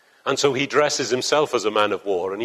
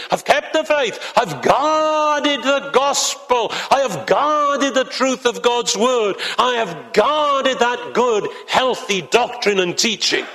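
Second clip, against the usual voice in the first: much higher, slow, solemn proclamation; Paul's proud declaration; 10 semitones higher, slower than usual. Acted. Paul declaring he has guarded the faith, high and slow. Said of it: "encapsulated here".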